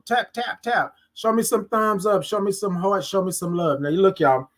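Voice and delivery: ordinary delivery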